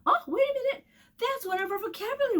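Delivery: high, excited gasp of discovery; mock surprise. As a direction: pretend you just spotted it. Teacher finds vocab word.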